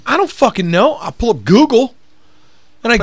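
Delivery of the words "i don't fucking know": much higher, fed-up answer; retelling his answer to a clueless customer; exasperated reply. Retold or imitated. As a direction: throw up your hands vocally. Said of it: "And I'm like".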